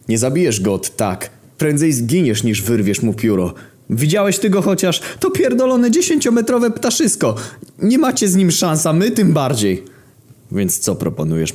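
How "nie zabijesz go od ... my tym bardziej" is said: squealing in terror about the giant rooster; panicked warning. Acted. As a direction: shriek it in rising panic. Panicked Greek warns of giant rooster.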